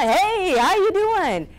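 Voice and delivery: high-pitched hyped DJ greeting; cranked-up DJ. Acted. Speaker mocking a fake DJ, hammed-up and high. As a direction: put on a fake, over-pumped DJ voice. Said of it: "like a, a D J who's just been cranked on".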